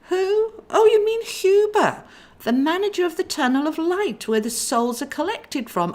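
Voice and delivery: high, loud surprised angel; Clarabel voice. Acted. Sister surprised at brother's plan. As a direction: squeak out the name in surprise.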